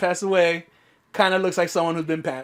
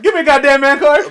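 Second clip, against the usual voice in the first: higher, louder mock macho demand; 7 semitones higher, 10 dB louder than usual. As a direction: shout it like a hurt tough guy. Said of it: "repeated louder, higher".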